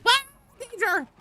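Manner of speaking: high, loud burst of alarm; sudden high-pitched alert shout; alarmed yell. Acted. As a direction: yelp it like a sudden warning. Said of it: "primed to be like".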